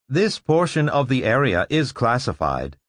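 lightly voiced machine guard; cool mechanical robot tone. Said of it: "the robot said".

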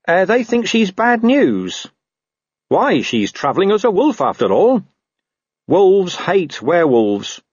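a hint of a world-weary dog; talking dog. Gaspode warns Carrot about Angua, lightly voiced dog. Gaspode explains the wolves' gossip.

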